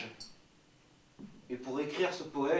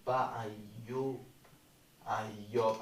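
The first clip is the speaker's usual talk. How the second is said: usual speaking voice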